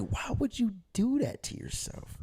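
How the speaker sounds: high, soft incredulous question; baffled curiosity. Retold or imitated. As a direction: imitate your naive younger self. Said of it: "'cause I'm curious".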